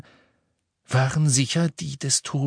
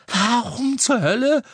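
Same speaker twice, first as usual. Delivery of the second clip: much higher, louder, panting question; gasping Ron; 13 semitones higher, 5 dB louder than usual. A narrator's reading. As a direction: gasp it out in disbelief. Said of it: "keuchte Ron". (keuchend und fassungslos)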